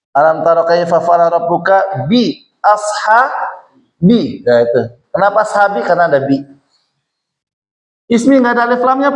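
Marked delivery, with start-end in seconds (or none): 0.1-4.4 slow, chanted recitation of the verse; slow Quranic chant in a classroom. Shown as a demonstration. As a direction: recite it slowly like in prayer.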